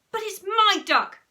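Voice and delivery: put-on loud, high child's protest; squabbling little boy's yell; indignant protest. A narrator's reading. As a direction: claim it back from your brother. Brothers squabble over a toy.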